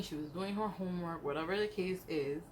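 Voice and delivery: normal talking voice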